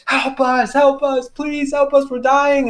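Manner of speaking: high, pleading call; retold high-pitched plea for rescue; cry for help. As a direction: call desperately to passing cars for help.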